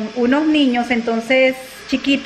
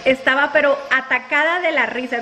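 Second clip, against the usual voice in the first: usual speaking voice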